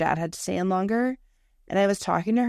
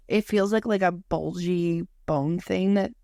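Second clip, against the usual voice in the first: ordinary talk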